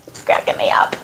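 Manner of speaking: whisper. Real, not acted. Laughing aside to viewers.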